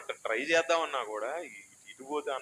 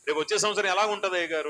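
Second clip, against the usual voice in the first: anxious congregant; 6 semitones higher, 4 dB louder, faster than usual. Retold or imitated.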